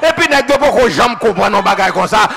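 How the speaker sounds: loud, rhythmic Haitian sermon cadence; fiery pulpit preaching building up; full-force preaching. Acted. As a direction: preach it out to the whole church. Preaching to the congregation.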